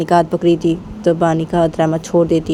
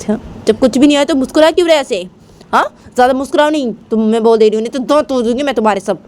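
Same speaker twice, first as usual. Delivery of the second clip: high, loud and fast, a furious threat; angry scolding; 8 semitones higher, 4 dB louder, faster than usual. Acted. Raima threatens Bani for smiling, in a high loud angry scold. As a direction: snap at her to stop smiling, voice pitched up hard.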